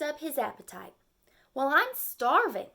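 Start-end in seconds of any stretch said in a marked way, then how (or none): 1.5-2.7 high, loud cartoon hunger; hungry turtle. Turtle tells his friend he's starving, high and loud. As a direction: announce your hunger big.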